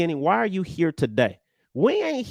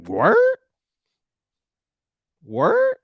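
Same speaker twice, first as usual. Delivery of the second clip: mock disbelief; 7 dB louder than usual. Mock incredulous reply.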